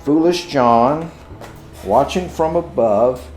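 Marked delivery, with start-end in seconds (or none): none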